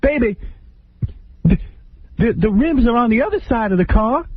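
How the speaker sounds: high falsetto reporting voice; Whitney explaining where the rims are, hammed up high; mock investigative reporter Whitney. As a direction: report it like a squeaky sleuth. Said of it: "like an investigative reporter".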